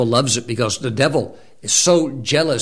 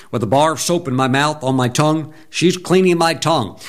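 normal talking voice

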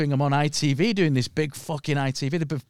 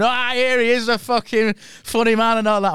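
calling out; 6 semitones higher, 3 dB louder than usual. Retold or imitated. Workmen calling out to him.

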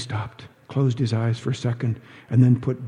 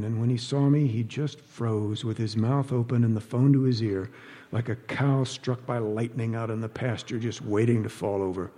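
usual speaking voice

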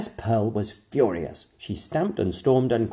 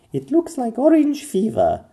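high, louder princess voice; Pearl's voice; 7 dB louder than usual. A narrator's reading. Pearl diagnosing the king, confident. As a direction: sure little doctor. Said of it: "Pearl was pretty sure".

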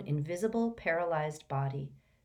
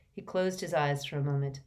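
plain reading voice

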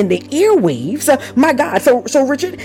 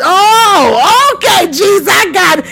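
high, drawn-out "oh" of sudden understanding; dawning realization; 9 semitones higher, 3 dB louder, slower than usual. Retold or imitated. Preacher replays catching up with Jesus, dawning realization. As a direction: exclaim it as it finally clicks.

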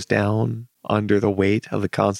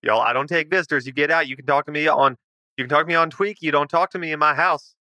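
high, hurried, defensive homeowner; hostile recluse; 5 semitones higher, much faster than usual. Acted. Homeowner refuses to see the visitors, defensive. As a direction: refuse visitors in a raised, insistent pitch. Game master plays Carl.

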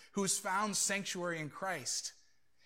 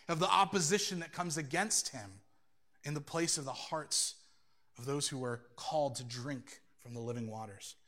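ordinary talk, nothing marked

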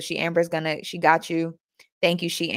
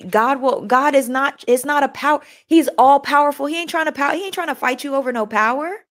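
higher, raised preaching voice; fervent preaching; 6 semitones higher than usual. Acted. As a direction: preach it with rising fervor. Preaching about God's power.

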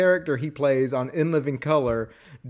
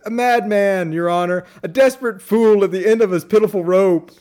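louder, higher mock melodrama; Fletcher; 5 semitones higher, 5 dB louder than usual. Fletcher answering the judge, theatrical imitation. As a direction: declaim it to the court, hammed up. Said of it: "And Fletcher goes".

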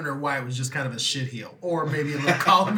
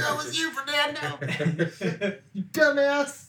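high, laughing mock accusation; laughing blame; 9 semitones higher than usual. Real, not acted. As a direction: tease him through laughter.

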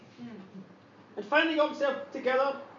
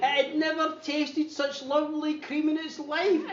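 ordinary talk

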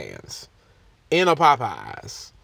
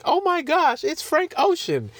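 mock fan excitement; 12 semitones higher than usual. Fans guessing the secret guest, mock giddy high squeal. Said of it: "Everyone was thinking".

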